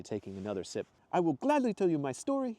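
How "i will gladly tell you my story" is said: high, glad Italian voice; eager Italian storyteller; Italian accent. Acted. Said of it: "his faded Italian accent".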